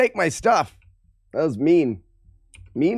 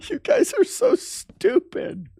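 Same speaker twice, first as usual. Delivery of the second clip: high, slow squeaky put-on voice; mock high voice; 14 semitones higher, slower than usual. Streamer mocking chat in a squeaky high voice. Laughing at chat's names.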